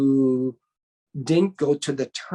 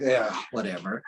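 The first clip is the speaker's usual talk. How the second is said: imitated dismissiveness; 7 semitones lower, 3 dB quieter than usual. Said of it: "just goes like this".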